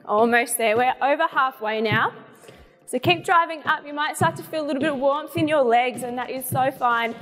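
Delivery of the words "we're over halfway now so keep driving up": normal talking voice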